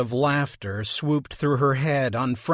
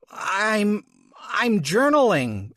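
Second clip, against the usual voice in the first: nervous stammer; 6 semitones higher, slower than usual. Acted. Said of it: "her mouth suddenly dry as a bone".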